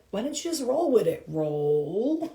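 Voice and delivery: normal talking voice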